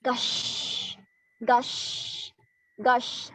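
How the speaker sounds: whooshing, drawn-out "gush" like wind; imitated wind gush. Shown as a demonstration. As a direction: let each gush trail into a long hushed "sh". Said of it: "What is the sound of gushing of the wind".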